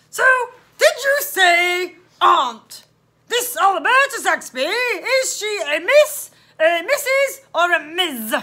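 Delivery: loud, slow, laboured questions; loud officer, drawn-out and pedantic; interrogating policeman. Acted. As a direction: boom each option out deliberately. Policeman interrogating loudly, slowly.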